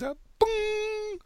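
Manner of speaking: high vocal boom, cannon blast imitation; boom noise. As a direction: belt out a silly boom sound. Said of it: "Oh, I wanna make that noise".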